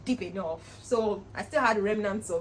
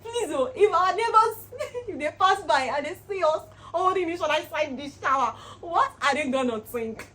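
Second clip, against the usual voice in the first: higher and louder, half laughing in alarm; panicked about the neighbours; flustered plea; 8 semitones higher, 8 dB louder than usual. Retold or imitated. Vlogger voicing fear neighbours see them, laughing alarm.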